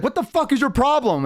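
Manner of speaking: high-pitched mock outrage; retold indignant challenge. Shoved patron to the orcs, indignant. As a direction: imitate an offended tavern patron. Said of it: "and they'd be like".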